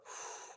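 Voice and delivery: long breathy sigh out; audible emptying out-breath; demonstration exhale. Shown as a demonstration. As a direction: push the breath out past its natural end. Shows breathing out too low.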